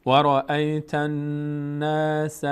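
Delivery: slow recitation, long nasal n in "الناس"; ghunnah. Shown as a demonstration. Teacher applying doubled noon rule, n held nasally. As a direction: chant it, holding the n of "الناس". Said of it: "نون تشدید دار".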